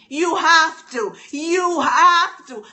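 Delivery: usual speaking voice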